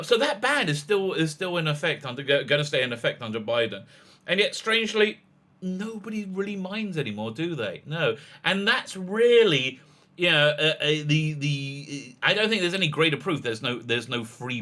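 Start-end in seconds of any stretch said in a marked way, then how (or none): none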